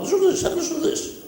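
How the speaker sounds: high, fast excited host call; Alan Jones impression. Shock jock hyping his listeners, high fast call. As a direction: rattle it off like a frantic talkback host. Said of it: "Mister Alan Jones".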